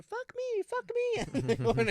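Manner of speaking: very high put-on voice; mock sex robot. Co-host mimics the robot's endlessly repeated phrase, high falsetto.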